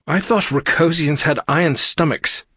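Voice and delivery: high, put-on voice; chiding character voice. A narrator's reading. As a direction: remind someone pointedly. Aria reminding sick crewmate.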